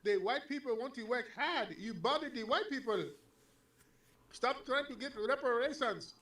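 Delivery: high-pitched mocking imitation of a caller; mock scolding caller. Host mimicking the caller's scolding.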